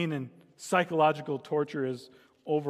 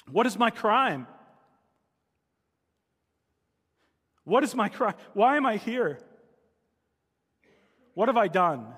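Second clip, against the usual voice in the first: higher, louder desperate plea; crying out; 4 semitones higher, 4 dB louder than usual. A narrator's reading. Prisoner Edmund crying out in his stone cell, desperate raised plea. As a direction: demand an answer from the cell. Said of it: "And he cries out".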